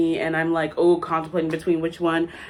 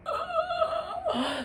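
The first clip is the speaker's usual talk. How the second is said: shocked gasp; 10 dB quieter than usual. Real, not acted. Shrimp price shock.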